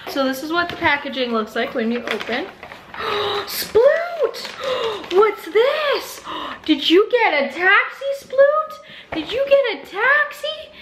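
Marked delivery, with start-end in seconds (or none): 2.9-10.7 higher gasps and excited cooing; delighted gasps at each toy. Real, not acted. Owner unboxing toys for her corgi, gasping excited coo.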